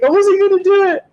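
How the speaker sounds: hammed-up teary athlete fighting tears; Cuba Gooding crying bit. Imitating Cuba Gooding in the interview, high and pleading. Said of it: "He's like".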